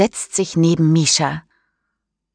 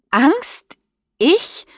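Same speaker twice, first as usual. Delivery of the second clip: much higher, mock-offended "Angst? Ich?"; incredulous call; 10 semitones higher than usual. A narrator's reading. Mischa calls back to Daniel, high incredulous protest. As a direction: toss it back, outraged at the idea. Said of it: "ruft Mischa zurück".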